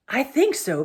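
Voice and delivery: mildly winded reply; panting. A narrator's reading. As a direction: answer as if catching your breath. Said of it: "panted Edmund".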